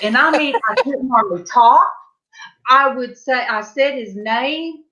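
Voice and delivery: ordinary talk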